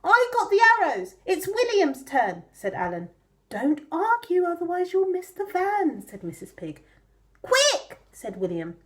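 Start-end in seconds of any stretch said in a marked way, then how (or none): 0.0-2.4 high piglet arguing. Acted. Piglet Alan voiced. 3.5-6.1 mother pig scolding. Acted. 7.4-8.0 high, loud urgent piglet. Acted.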